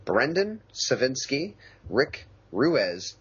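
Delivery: ordinary talk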